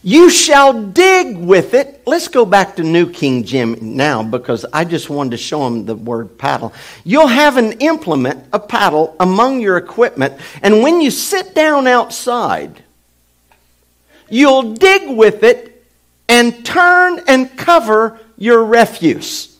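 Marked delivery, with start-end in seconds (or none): 0.0-2.0 booming slow preacher voice; slow, loud and high pulpit delivery; preaching. Acted. Preacher quoting Moses' instructions. 7.0-19.5 loud, high preacher proclamation; preaching. Acted. Preacher quoting Moses' instructions.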